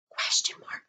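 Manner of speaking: whispered answer; hushed answer to the class. Shown as a demonstration. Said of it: "whispered answer".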